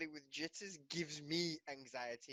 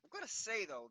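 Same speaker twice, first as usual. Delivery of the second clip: normal talking voice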